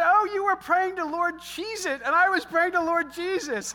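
high, loud, laughing through the words; can barely get it out for laughing. Retold or imitated. Husband teasing wife about Cheez-Its, laughing. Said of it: "we just burst out laughing".

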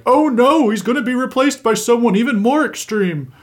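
high-pitched, exaggerated alarm; mock panic. Imitating the right freaking out over a replacement justice, mock panic. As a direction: wail it in hammed-up dismay. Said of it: "freak out a bit".

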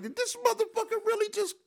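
high, loud, strained disbelief; incredulous. Retold or imitated. After Paul Mooney snubs him, high incredulous outrage. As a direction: squeak it out like you can't believe it.

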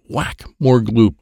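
ordinary delivery

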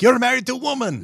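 mock Russian accent. Mocking the Russian neighbor.

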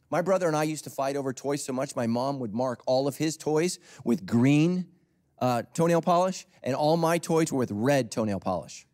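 usual speaking voice

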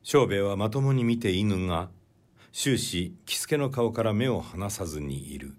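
ordinary delivery

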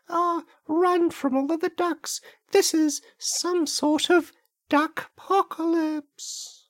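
very high, loud hammed-up squeal of terror; panicked shriek. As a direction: shriek like a cartoon victim swarmed by ducks. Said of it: "they're gonna hear this".

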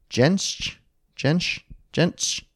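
testing how Jentsch? is said, rising and unsure; Jentsch? tried with a rising question tune; trying out the name. Shown as a demonstration. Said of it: "Anyway, someone German".

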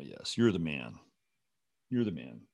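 normal talking voice

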